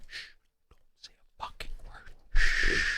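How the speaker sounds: barely audible voiceless shushing; urgent hushing whisper. Retold or imitated. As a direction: whisper as if hiding from attackers. Hiding on floor, hushing friends.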